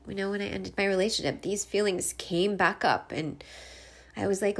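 usual speaking voice

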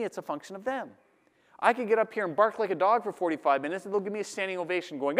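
ordinary delivery

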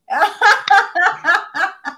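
loud real laugh; loud burst of laughter. Real, not acted. Laughing at chat joke.

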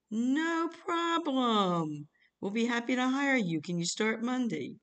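high, drawn-out sing-song reassurance; mock-eager, swooping slow pitch; enthusiastic caller. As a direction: stretch it out high and breezy, imitating an eager recruiter. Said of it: "calls back very enthusiastic".

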